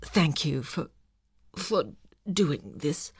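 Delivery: soft, slow, cracking voice. A narrator's reading. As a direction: let the voice break, faltering. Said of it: "He made his voice crack".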